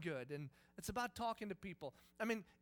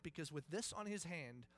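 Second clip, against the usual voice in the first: ordinary delivery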